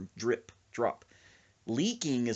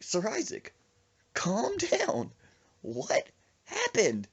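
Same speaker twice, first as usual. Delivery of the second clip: raised pitch, slow deliberate words; insistent calming; 11 semitones higher, much slower than usual. Retold or imitated. Dad settling his son's panicked friend, emphatic slow insistence. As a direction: space out each phrase for someone hysterical.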